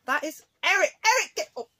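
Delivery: sudden very loud, high shout at the cat; real yell. Real, not acted. Owner yelling at her cat on the Fimo, then apologising. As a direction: bark the cat's name to stop him, then a sheepish sorry. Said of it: "I've just yelled so loudly".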